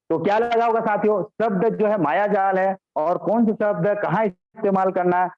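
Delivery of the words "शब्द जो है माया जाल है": normal talking voice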